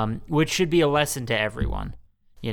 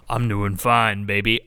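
a dropped, lower smooth voice; mock deep voice; 4 semitones lower than usual. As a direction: drop the voice like it just broke. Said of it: "Does your voice drop again too?".

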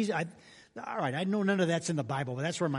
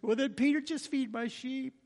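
gentle commission; 7 semitones higher than usual. Pretend. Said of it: "Jesus to Peter".

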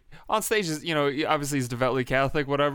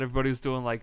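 ordinary talk, nothing marked

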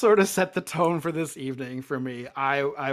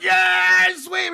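loud, high cheer; cheering crowd; 8 dB louder than usual. Retold or imitated. Crowd cheering as the sweeper returns. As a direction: yell it like a wild crowd.

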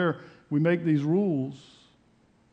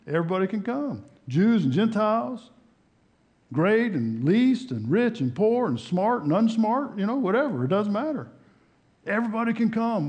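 emphatic preaching; 8 semitones higher, 5 dB louder than usual. Real, not acted. Preacher declaring the new covenant open to all, emphatic preaching. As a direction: proclaim it to the room, pitch lifted and swinging.

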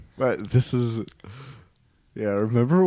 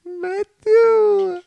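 very high falsetto, louder, drawn out; parent back from the dead; 5 dB louder than usual. Acted. Dead parent calling to the child who killed them, falsetto parent. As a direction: call out in a piping falsetto.

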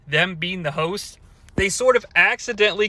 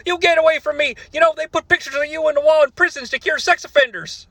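mock Cornette; 8 semitones higher, faster than usual. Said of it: "[name] chimes in".

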